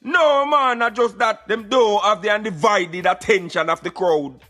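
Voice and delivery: ordinary talk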